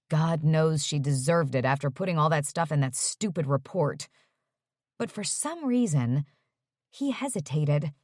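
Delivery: ordinary delivery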